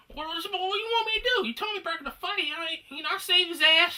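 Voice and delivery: indignant. Real, not acted. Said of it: "defending himself, indignant".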